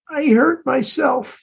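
a light, understated sob in the words; blubbering. A narrator's reading. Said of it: "she blubbered".

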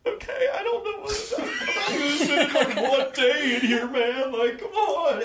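very high, panicky gnome voice; squeaky scared gnome. Acted. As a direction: plead like a terrified little gnome.

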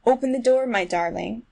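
usual speaking voice

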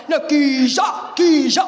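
loud Cockney "geezer", hard g; shouting "geezer" at the crowd in Cockney. Shown as a demonstration. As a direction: bark "geezer" like a proper bloke. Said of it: "proper fucking geezer".

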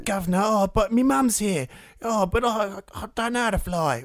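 put-on a posh little boy straining at a Cockney accent; mock bad Cockney. Mocking the Pan kid's failing Cockney accent, pitched high. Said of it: "desperately trying to do a Cockney accent".

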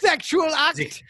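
put-on slow, high shout in a camp German accent. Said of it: "I just thought you shouted out".

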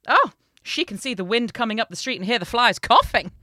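loud, animated storybook mother; mother's voice. A narrator's reading. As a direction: proclaim it loudly as the boasting mother. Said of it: "And the mother said".

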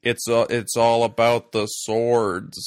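loud, slow put-on American drawl; mock American accent. Mocking Craig's unconvincing American voice, loud and slow. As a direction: ham up a bad Yank accent. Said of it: "American accent that's so unconvincing".

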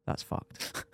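whispered, blunt that's fucked; hushed blunt verdict. Retold or imitated. Doctor tells the rider his wrist is wrecked, in a whisper. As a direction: drop to a whisper for the bad news.

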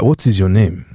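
low-pitched, lightly voiced Nigerian questioner; a hint of a man in Niger asking; Nigerian local. Man in Niger asks the visitor his name, lightly voiced local. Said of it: "if I go over to Niger".

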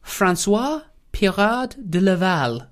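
drawn-out French pronunciation; French name savoured slowly, pitch lifted; French accent. Acted. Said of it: "the very French name".

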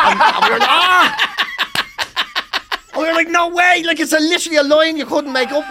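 high, loud, talking through laughter; helpless laughter while telling it; laughing hard. Real, not acted.

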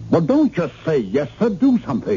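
high-pitched exasperated order; exasperated demand. Acted. Cut-off caller orders operator to act, exasperated. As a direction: demand action, pitch climbing sharply. Berates operator for not acting.

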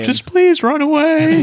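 high, loud, pleading squeak; quivering frightened little voice; mock-scared squeaky plea to the enemy. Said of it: "your quivering little frightened voice".

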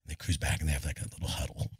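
dropped to a near whisper; hushed. Retold or imitated. Storyteller hushing as officials huddle. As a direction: hush it like a secret huddle.

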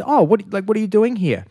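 high-pitched, interested question; imitated curious surprise. Uncle quizzing the Austrian stranger, curious and surprised. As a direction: lift it up high like a curious local.

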